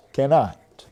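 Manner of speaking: usual speaking voice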